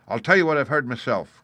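lightly voiced captain laying down his objections; blunt captain, airing grievances. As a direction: state it firmly, punching key words.

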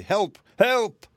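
high, loud cries for help; wild shouting. A narrator's reading. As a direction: yell frantically for rescue. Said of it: "shouting wildly".

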